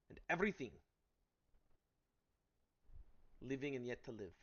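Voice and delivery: loud, rising to a shout. Acted. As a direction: shout it with full force. Heated quarrel between companions.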